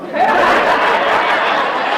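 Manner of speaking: high breathy burst of laughter; big laugh. Real, not acted. Speaker cracking up after his speed joke, loud laugh. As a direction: laugh hard at your own punchline.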